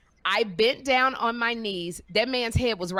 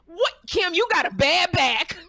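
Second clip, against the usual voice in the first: higher, incredulous telling-off of a friend; exasperated scolding; 8 semitones higher, 4 dB louder than usual. Retold or imitated. Telling friend Kim off for her bad back, higher and exasperated. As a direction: scold her like she's lost her mind.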